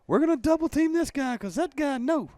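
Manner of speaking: very high, loud comic yelling; hammed-up frantic high voice; mock panicked center. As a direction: shout the blocking plan in pretend panic. Continues acting the panicked center.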